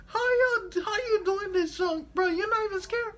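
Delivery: high falsetto disbelief; mock amazed girl. T J amazed that John isn't scared, high falsetto. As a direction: squeak out the amazement. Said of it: "she like".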